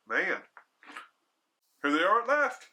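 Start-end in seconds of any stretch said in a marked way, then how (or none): 1.8-2.7 high, eager cry of relief; cried out eagerly. A narrator's reading. Hostess spotting the hunters at the window, high eager cry. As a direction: call it out high as they come into view. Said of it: "she cried".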